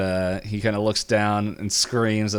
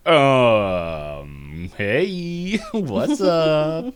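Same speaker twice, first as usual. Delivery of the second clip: Robbie/character; 4 semitones higher, 4 dB louder, much slower than usual. Retold or imitated. Robbie caught lying in the grave.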